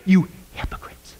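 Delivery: hushed, breathy warning; a stern whispered rebuke to hypocrites. Acted. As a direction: whisper it as Jesus chiding them. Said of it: "Jesus would say to you".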